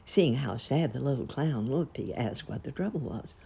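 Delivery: plain reading voice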